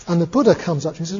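ordinary delivery, nothing marked

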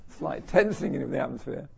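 ordinary talk, nothing marked

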